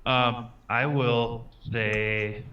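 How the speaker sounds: ordinary delivery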